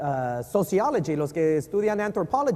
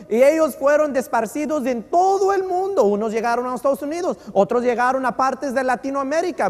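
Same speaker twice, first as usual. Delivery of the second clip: loud, high, urgent pulpit telling; preaching at full force; 7 semitones higher, 5 dB louder than usual. Acted. Preacher to congregation about the diaspora, loud and high. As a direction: sweep through the scattering with fervor.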